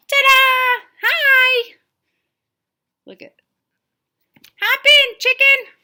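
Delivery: very high, loud elephant voice; cartoon elephant. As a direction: greet them like a giddy cartoon elephant. Elephant appears in story.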